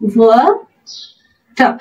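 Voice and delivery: ওয়াটার with the final r dropped, British style; silent final r. Shown as a demonstration. Teacher shows learners British pronunciation, ওয়াটার without the r. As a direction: say ওয়াটার slowly, ending on an open vowel. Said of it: "British অ্যাকসেন্টে সেটাকে উচ্চারণ করা হয় না".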